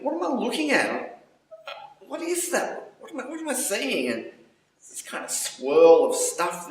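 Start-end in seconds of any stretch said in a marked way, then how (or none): 0.0-4.1 high, laughing disbelief; bewildered. Retold or imitated. Dazed man waking up, asking what he sees, high and laughing. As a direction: pitch it way up, half-laughing, baffled.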